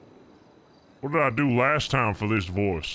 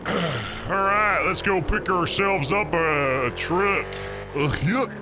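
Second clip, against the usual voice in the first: trucker voice; 7 semitones higher than usual. Pretend. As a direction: rev up like a trucker starting the haul. Trucker character heading for truck.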